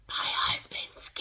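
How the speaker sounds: slow, voiceless whisper; imitated secretive whisper. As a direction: whisper it like a secret in someone's ear. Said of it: "the lady whispered in his ear".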